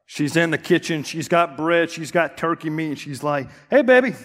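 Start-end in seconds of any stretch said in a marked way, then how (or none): 3.7-4.1 put-on high, loud breezy hey baby; wife's casual greeting. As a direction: toss it off, carefree. Wife casually greeting panicked husband.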